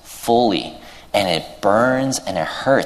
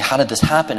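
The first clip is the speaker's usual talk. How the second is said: retold panic, pitched higher; mildly acted frustrated bewilderment in a higher voice; panicked, frustrated; 6 semitones higher, faster than usual. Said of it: "he's panicking, and he's sad, and he's frustrated".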